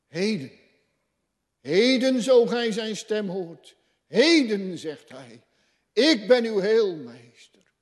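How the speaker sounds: high, loud urgent call; urgent preaching cry. Acted. Preacher urging listeners to come today, high and loud. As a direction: call them in today with full force.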